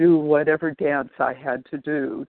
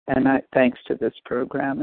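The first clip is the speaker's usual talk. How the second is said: ordinary delivery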